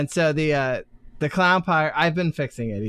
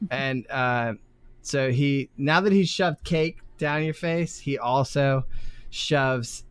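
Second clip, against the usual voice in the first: ordinary talk, nothing marked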